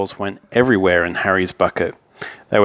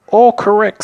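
high, loud announcement; raised, triumphant verdict; emphatic confirmation; 11 semitones higher, 6 dB louder than usual. Acted. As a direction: declare it right, high and loud. Man confirms the dinosaurs.

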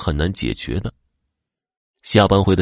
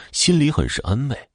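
usual speaking voice

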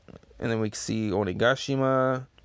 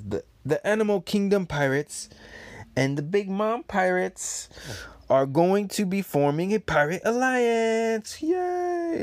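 gleeful villain announcement; 9 semitones higher than usual. Acted. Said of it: "Kaido and Big Mom there says".

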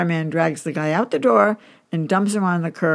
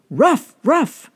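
high, loud bark-like "Rough"; dog barking words; 9 semitones higher, 7 dB louder than usual. A narrator's reading. Rover answers his master, barking high and loud. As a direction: bark each word out like a dog. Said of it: "The dog goes".

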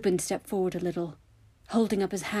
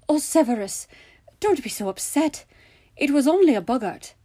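higher, louder, coaxing protest; pleading, exasperated soothing; placating; 7 semitones higher, 6 dB louder than usual. A narrator's reading. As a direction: try to calm him down. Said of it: "tried to calm him down".

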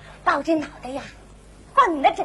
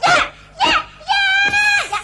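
mock frantic yelling; 14 semitones higher, 3 dB louder, slower than usual. Mimics screaming and jumping.

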